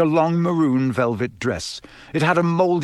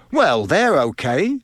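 angry; 4 semitones higher, 4 dB louder, slower than usual. Acted. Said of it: "said Ron angrily".

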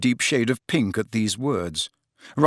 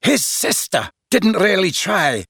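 raised, needling accusation; menacing, higher-pitched challenge; 7 semitones higher than usual. A narrator's reading. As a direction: throw it out as a threat. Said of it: "said McLaggen menacingly".

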